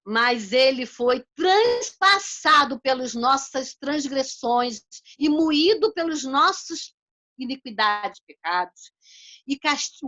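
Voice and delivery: slow, loud, measured Bible reading; solemn scripture reading. Acted. Preacher reading the verse to the congregation, slow and solemn. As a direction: read each word weighty and deliberate. Reads Isaiah aloud.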